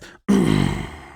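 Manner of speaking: deep, loud growling throat clear; gravelly throat clear. Shown as a demonstration. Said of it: "Kinda like this".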